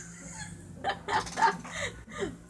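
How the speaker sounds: high, breaking-up laughter; real high giggling fit; laughing fit. Real, not acted. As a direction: crack up completely. Cracks up at niet.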